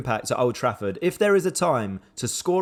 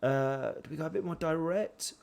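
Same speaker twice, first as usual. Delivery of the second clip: much softer, thinking aloud; soft musing to himself; quiet musing; 6 dB quieter than usual. Real, not acted. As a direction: ponder the tactics under your breath.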